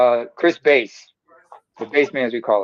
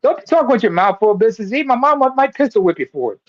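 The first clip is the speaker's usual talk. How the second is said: high mock scolding voice; mock scolding mom; 7 semitones higher than usual. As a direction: scold him like a strict mom. Scolding a friend for eating.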